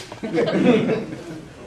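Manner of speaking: breathy burst of laughter; laughing. Real, not acted. Speaker cracking up at his own Egypt story, laughing.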